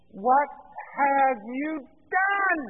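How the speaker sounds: drawn-out, high, loud condemnation; angry accusation. Acted. Samuel interrupts Saul's greeting, angrily accusing him. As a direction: stretch each word out in outraged accusation. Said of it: "The tone of voice, everything".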